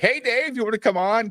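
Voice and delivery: high, sing-song mock greeting. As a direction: chirp the invite like a cheery host.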